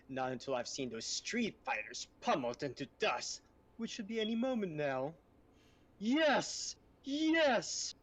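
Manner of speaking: hammy villain voice, rising to gloating shouts; M. Bison impression. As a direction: gloat like a cartoon dictator. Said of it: "I can do an M. Bison impression".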